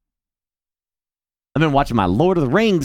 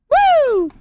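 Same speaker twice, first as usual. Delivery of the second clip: excited whoop; 4 dB louder than usual. Real, not acted. Hyping chat before the video.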